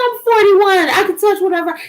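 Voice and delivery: indignant protest. Retold or imitated. Daughter talking back to her mother, high indignant. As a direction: insist you're a grown woman. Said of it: "I'm like".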